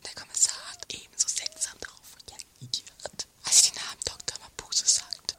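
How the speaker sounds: unvoiced secretive whisper. Confiding a suspicion about the commissioner, whispered.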